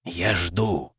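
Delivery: soft rumbling voice from the grave; rumbling sepulchral voice. Acted. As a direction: press her softly, rumbling from the tomb. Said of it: "всё тем же ракочущим голосом". (тихий рокочущий замогильный голос)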